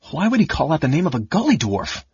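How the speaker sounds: demanding. A narrator's reading. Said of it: "Caramon demanded".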